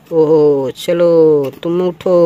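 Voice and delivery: usual speaking voice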